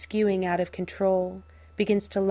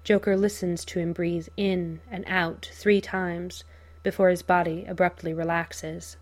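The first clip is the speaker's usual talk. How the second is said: ordinary delivery, nothing marked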